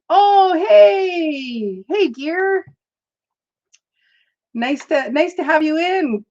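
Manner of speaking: high, bright hello to a viewer; light up and greet them; excited greeting. Real, not acted.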